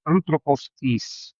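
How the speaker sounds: "Antropostis" said slowly as one single word, no break before tis; run together as one word. Shown as a demonstration. Teacher showing students the two words as one. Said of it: "devem ser pronunciadas juntas".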